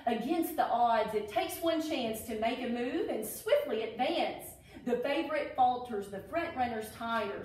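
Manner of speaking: lilting rhyming storybook read; reading to children. Acted. Teacher reading a Derby alphabet book to children, storybook voice. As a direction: read each verse like a picture book for kids.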